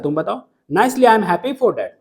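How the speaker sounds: ordinary delivery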